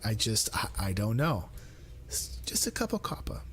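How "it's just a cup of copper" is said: high, soft, quick mock British; British accent. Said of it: "I gotta do the accent".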